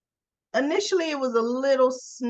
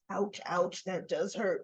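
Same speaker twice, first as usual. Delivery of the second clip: mild mock pain; 7 dB quieter than usual. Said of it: "He goes".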